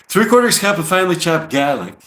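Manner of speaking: put-on a lightly done New York accent over a recipe list; mock New York accent. Reading [name]'s falafel recipe aloud, in a mock New York accent. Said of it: "I'm trying to do the, uh, New York accent now".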